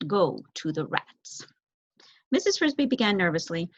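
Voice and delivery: ordinary delivery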